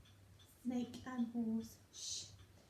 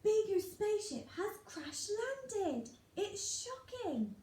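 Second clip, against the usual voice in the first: higher shocked read-aloud, punchy; read with expression; 7 semitones higher than usual. Shown as a demonstration. Teacher showing pupils how to read with expression, excited. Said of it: "I want to read it with expression".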